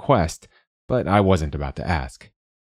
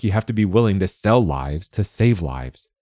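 plain reading voice

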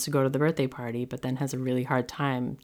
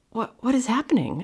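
alarmed; 9 semitones higher than usual. Retold or imitated. Mom shocked at crying child.

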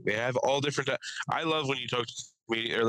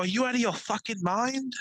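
Gordon Ramsay as pot smoker; 11 semitones higher than usual. Retold or imitated. Comedian imitating the chef yelling at his dealer. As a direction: rage like a snobby chef who got the wrong rolling paper. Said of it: "he was acting like Gordon".

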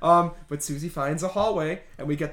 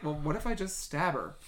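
much softer, offhand; deadpan; 3 semitones lower, 10 dB quieter than usual. Retold or imitated. Susie casually deciding to stab the witch, soft deadpan. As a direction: shrug it off. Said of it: "Susie's just like".

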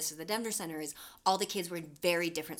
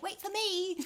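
Piggy; 12 semitones higher, slower than usual. Acted. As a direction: plead in a high, put-on voice. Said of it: "a voice being like".

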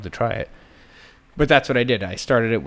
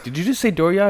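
surprised; 8 semitones higher, 4 dB louder than usual. Retold or imitated. Disbelief at seller's odd word.

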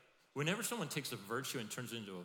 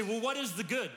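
high, loud challenging question; mock objection, loud and high; loud objector; 11 semitones higher, 11 dB louder than usual.

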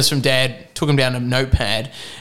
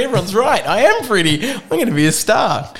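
mock-excited, higher-pitched self-belief; 7 semitones higher than usual. Teen tells himself he will be a star, mildly excited. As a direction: play the starstruck teen, lightly.

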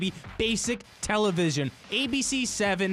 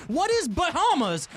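higher-pitched imitation of mom calling out answers; mock quiz-show call-out, pitched up; retold yelling out; 8 semitones higher than usual. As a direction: blurt a quiz answer like an eager mom, mildly raised. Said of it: "She's yelling out something".

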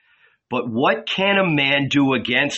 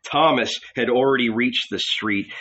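plain reading voice

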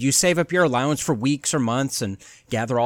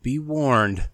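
ominous announcer. Acted. Host welcoming listeners to a spooky podcast, theatrical announcer voice. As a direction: lure listeners in like a haunted house guide.

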